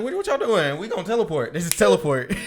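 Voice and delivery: high, loud, amused scoff; excited scoff. Retold or imitated. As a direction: laugh off their worry. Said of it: "he's like".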